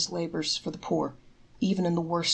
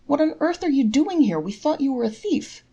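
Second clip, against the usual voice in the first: shocked innkeeper; 9 semitones higher, 5 dB louder than usual. A narrator's reading. Innkeeper shocked to find him.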